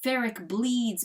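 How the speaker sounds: plain reading voice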